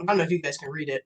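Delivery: ordinary talk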